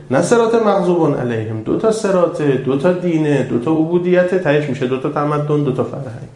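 usual speaking voice

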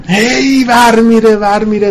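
a hint of the coaxing inner voice, drawn out; lightly voiced nagging self; nagging tempter. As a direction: mimic the ego going on and on.

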